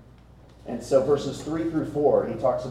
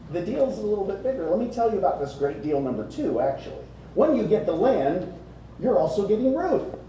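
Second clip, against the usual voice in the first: lightly acted sales pitch, lifting higher on the catch; spring the catch on the buyer; playful deal pitch; 6 semitones higher than usual. Acting out Boaz's sales pitch.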